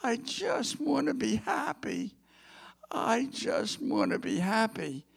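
high, whiny complaint; whining voice. Retold or imitated. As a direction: whine it like a spoiled bride. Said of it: "said with a whining voice".

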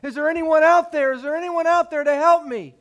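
crying out for help. Acted. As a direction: yell up the mountain, begging for rescue. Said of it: "he starts to cry out".

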